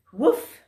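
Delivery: a high, loud puff of breath; a giraffe's failed try at smoke; puffing blow. Acted.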